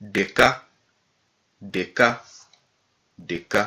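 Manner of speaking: "D K" with the tongue on the palate for D. Shown as a demonstration. Teacher repeats special letters, "D K" with palatal D. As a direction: put your tongue to the palate on the D of "D K". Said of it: "avec notre langue sur le palais".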